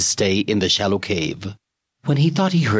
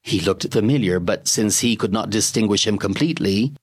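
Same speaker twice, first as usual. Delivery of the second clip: usual speaking voice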